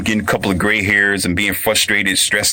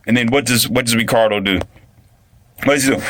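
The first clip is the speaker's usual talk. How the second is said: ordinary talk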